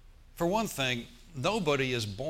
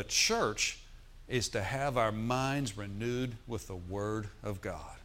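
slow solemn quote; slower than usual. Shown as a demonstration. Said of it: "This is a profound statement".

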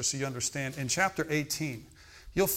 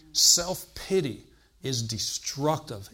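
slow emphasis; 3 dB louder, much slower than usual. Real, not acted. Preacher stressing his point.

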